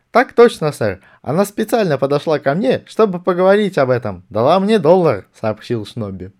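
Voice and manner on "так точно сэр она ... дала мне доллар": high, loud soldier's report; corporal reporting stiffly. A narrator's reading. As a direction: stare straight ahead and report. (доклад капрала, высоко и громко)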